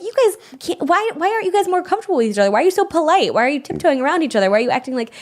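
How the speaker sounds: high, fast, incredulous questions at polite couples; exasperated mock plea. Mimicking the speaker's own baffled questions to polite couples, high and fast. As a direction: pester them, baffled by their politeness. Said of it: "I'm like".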